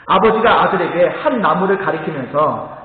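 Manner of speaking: ordinary talk, nothing marked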